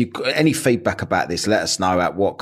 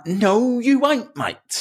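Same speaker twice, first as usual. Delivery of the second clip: scoffing retort; much slower than usual. Retold or imitated. Retorted reply to the bloke.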